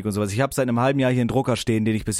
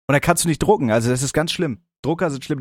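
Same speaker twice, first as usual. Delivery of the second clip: normal talking voice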